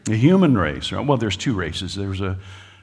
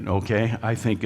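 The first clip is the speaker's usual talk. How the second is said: ordinary talk, nothing marked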